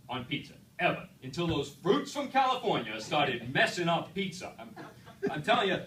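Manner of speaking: loud, indignant New York rant; Vinny's rant. Retold or imitated. Vinny ranting at the speaker about pineapple, loud and angry. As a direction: rail against California fruit. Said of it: "You imagine the rant".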